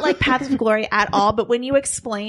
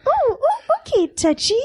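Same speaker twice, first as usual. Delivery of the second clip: princess voice; 12 semitones higher than usual. Acted.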